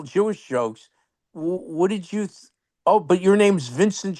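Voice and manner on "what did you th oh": usual speaking voice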